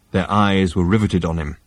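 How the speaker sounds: plain reading voice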